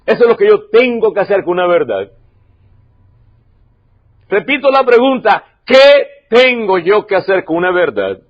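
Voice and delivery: usual speaking voice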